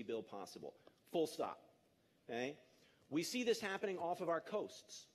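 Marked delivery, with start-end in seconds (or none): none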